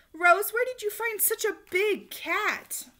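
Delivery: high, slow amazed question; curious customer. A narrator's reading. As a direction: ask in wonder. Customer asking Rose.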